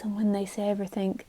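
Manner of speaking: ordinary talk, nothing marked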